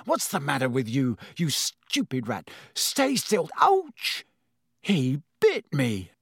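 irritated then stung; pained outburst. Acted. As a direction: yelp of pain on ouch. Ron struggles with bitten hand.

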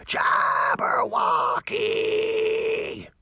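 very high, drawn-out shriek; monster scream. A narrator's reading. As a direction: screech it like a beast. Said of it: "it screamed".

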